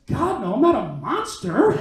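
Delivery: loud, high, drawn-out protest; indignant denial. Acted. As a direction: deny it as if deeply offended. He indignantly denies it.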